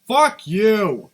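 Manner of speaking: loud, cutting shout; angry retort. Real, not acted. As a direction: snap it back angrily. Retort to the commentator.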